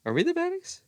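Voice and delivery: "Are we the baddies?" in a high squeaky mock voice. Quoting sketch title.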